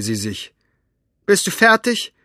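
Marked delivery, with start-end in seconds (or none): 1.2-2.1 curt. Acted. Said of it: "fragte sie".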